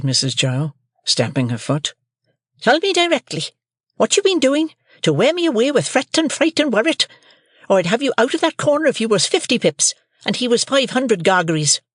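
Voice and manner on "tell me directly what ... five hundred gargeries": shrill high shrewish scold; scolding sister. A narrator's reading. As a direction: Mrs Joe scolds young Pip, high and shrill.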